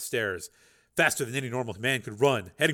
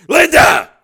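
full-throated yell, much higher and louder; desperate shout of warning; shouting at the top of his lungs; 22 dB louder than usual. Acted. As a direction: call her name out as loud as you can from a rooftop. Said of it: "Steele shouted at the top of his lungs".

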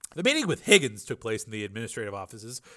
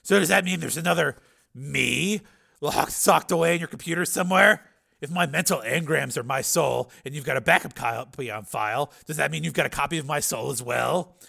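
louder, higher, pressing question; Steele, challenging; 5 semitones higher, 6 dB louder than usual. A narrator's reading. Patient challenges the scientist who rebuilt him, raised pressing voice. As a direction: push the question forward loudly.

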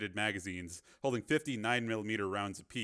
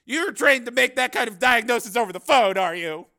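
loud, high scornful question; sarcastic challenge; 13 semitones higher, 13 dB louder than usual. A narrator's reading. Cameraman mocks reporter's diagnosis by phone, sarcastic challenge. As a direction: needle her with scorn.

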